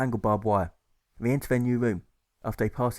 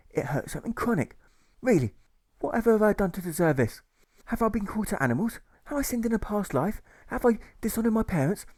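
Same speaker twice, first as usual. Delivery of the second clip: much higher, pleading cries; wailing lament; 11 semitones higher than usual. Acted. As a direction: moan to the heavens. Engineer lamenting his suffering.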